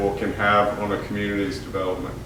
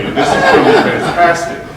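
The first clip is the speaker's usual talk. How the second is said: high, loud, laughing through the words; bursting with giddy anticipation; mock excited, with laughter breaking in; laughing excitedly; 7 dB louder than usual. Retold hopeful excitement, laughing.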